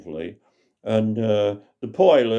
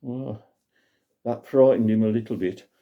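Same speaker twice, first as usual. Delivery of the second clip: ordinary delivery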